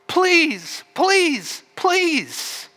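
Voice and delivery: very high, begging pleases; desperate pleading. Acted. Father begging his angry son, desperate. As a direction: beg him with each please. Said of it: "Pleaded with him".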